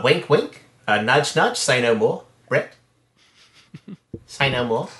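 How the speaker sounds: put-on lightly done New Zealand accent, Murray style; a hint of Murray from [name]; Murray impression. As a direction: Kiwi accent, mildly put on. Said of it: "I'll do it as Murray from [name]".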